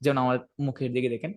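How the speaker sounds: ordinary delivery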